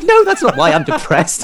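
laughing it out, high and loud. Retold or imitated. Retelling mum's question, laughing and loud.

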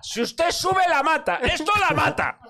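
high, insistent warning; high alarmed plea with a laugh; urgent warning. Retold or imitated. Warning old lady at ride.

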